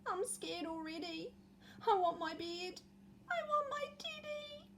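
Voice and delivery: put-on high, small frightened boy's voice; scared little Eddie. Eddie alone in the woods, high and frightened. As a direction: whimper like a lost little boy wanting his teddy. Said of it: "said Eddie".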